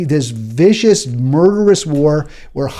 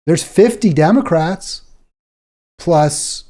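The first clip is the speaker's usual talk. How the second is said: normal talking voice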